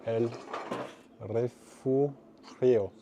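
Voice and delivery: "El Refugio" drawn out very slowly, rolled Spanish r, falling at the end; slow, effortful Spanish reading of "El Refugio"; stumbling through "El Refugio" in a Spanish accent. Shown as a demonstration. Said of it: "J'en peux plus de mon accent espagnol".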